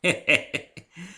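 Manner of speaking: high, loud country chuckle; a hearty little laugh. Acted. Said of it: "Applejack chuckled".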